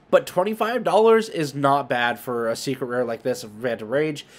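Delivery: normal talking voice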